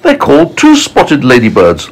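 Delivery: high-pitched, snooty storybook lady; high, scandalised ladybird voice; disdainful ladybird. Acted. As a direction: gossip in a high voice about lesser relatives. Ladybird disparages her relatives.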